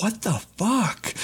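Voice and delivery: shocked high whisper. Retold or imitated. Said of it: "Tom's face, like".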